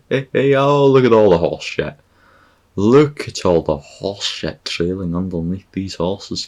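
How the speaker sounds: hammed-up shout of amazed disgust; mock exclaiming. Acted yelling at horse droppings.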